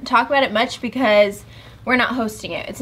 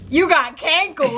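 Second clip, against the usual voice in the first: teasing husband voice; 6 semitones higher, slower than usual. Retold or imitated. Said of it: "he was like".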